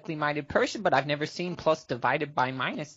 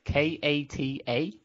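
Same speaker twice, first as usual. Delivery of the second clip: slow, letter-by-letter spelling; dictating; 3 semitones lower, slower than usual. Real, not acted. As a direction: spell each letter out clearly. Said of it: "I'll spell it out for you".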